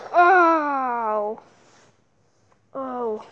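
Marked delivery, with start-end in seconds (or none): none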